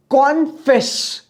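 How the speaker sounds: Confess said slowly as "con", "fess"; slow two-beat confess; split into two syllables. Shown as a demonstration. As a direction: pause briefly inside confess after "con". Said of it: "दो सांसें लग रही हैं".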